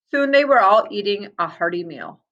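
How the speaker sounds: ordinary delivery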